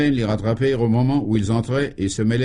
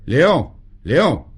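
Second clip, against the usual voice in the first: loud, higher call through a doorway; 5 semitones higher, 5 dB louder than usual. Acted. Minister calls his secretary through the door, loud call. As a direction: call him from the next room. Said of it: "il ouvrit une porte de communication et appela".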